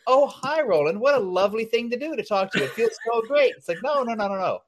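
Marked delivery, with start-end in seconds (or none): 0.1-3.5 higher, sugary fake politeness; mocking the chatty caller he refuses to be; mock gushing small talk. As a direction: gush like an over-friendly caller. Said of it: "I don't have time for small talk".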